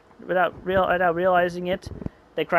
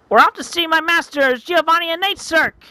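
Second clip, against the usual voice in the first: higher, louder taunting voice; gloating kidnapper; 12 semitones higher, 5 dB louder than usual. Acted. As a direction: taunt the captive as you carry her off.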